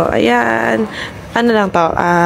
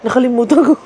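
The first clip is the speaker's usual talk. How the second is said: laughing; 3 semitones higher, faster than usual. Real, not acted. Embarrassed at forgetting anniversary.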